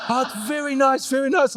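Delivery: laughing imitation of a reluctant mum; mock mum through laughter. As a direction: imitate mum's grudging praise while laughing. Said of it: "before she goes".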